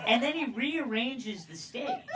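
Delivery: usual speaking voice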